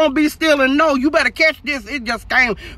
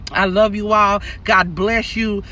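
ordinary delivery

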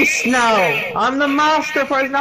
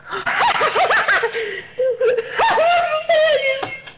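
wild high laugh. Acted. New regeneration, wild laugh.

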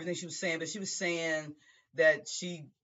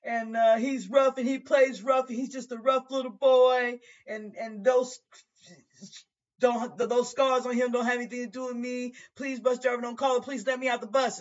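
higher voiced denying mother; pleading mother; 8 semitones higher than usual. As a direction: insist and beg to be let out. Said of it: "She said".

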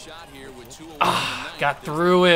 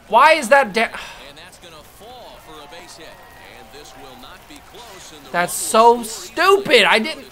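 angry outburst; 2 semitones higher, 4 dB louder than usual. Real, not acted. Gamer fuming at his own team, loud and slow. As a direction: spit it out, fed up.

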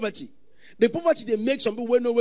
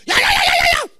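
very high, loud whooping yeahs; imitating shouting worshippers; crowd shouting; 11 dB louder, faster than usual. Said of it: "they shout".

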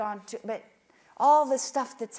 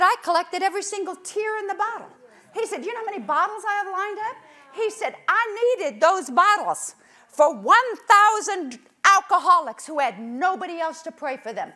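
high, loud fervent declaration; preaching at full force; booming proclamation; 8 semitones higher, 11 dB louder than usual. Retold or imitated. God's voice proclaimed loudly.

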